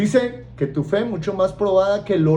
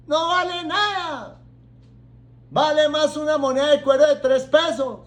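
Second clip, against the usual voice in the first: higher, slow scornful taunt; mocking accuser; 7 semitones higher than usual. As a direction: sneer like a reproachful relative. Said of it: "y te dice". (reproche burlón y agudo)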